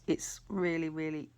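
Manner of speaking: ordinary talk, nothing marked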